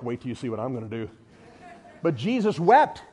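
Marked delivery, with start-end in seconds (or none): 0.0-1.1 low, soft and fast; fast, lower smirking whisper; smirking aside. Retold or imitated. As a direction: tease a secret surprise. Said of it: "I would have a smirk on my face like".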